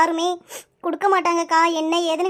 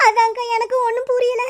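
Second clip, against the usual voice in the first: sobbing, voice pitched up; crying; 6 semitones higher than usual. Acted. Girl telling her elder of her missing father, sobbing. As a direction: cry through the words, catching sobs between phrases. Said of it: "அழாத".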